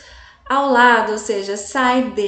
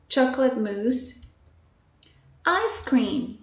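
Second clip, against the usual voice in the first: usual speaking voice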